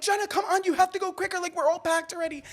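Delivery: mock frantic friend hurrying someone up; frantic urging. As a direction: rush her along in a panic. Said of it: "And I'm like".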